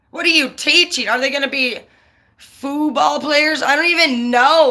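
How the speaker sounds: high, incredulous angry outburst; real anger, punching the swear words; pissed off. Real, not acted. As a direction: rant in outraged disbelief. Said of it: "I'm so pissed".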